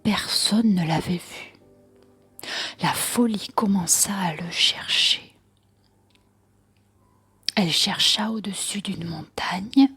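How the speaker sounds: usual speaking voice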